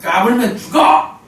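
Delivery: old man threatening the young man, slow mock menace; mock threat. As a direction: growl it out as a lightly voiced bully. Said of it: "아, 이러는 거예요".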